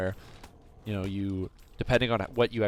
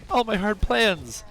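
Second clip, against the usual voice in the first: high, drawn-out mock wail; slow, hammed-up dismay of a thwarted villain; 10 semitones higher, much slower than usual. Villain learning all his henchmen are gone, comically dismayed. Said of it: "And he's like".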